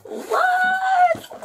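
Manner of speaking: very high delighted shriek; shrieked what. Real, not acted. Vlogger opening her package, screaming with joy. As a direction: scream it out in shock.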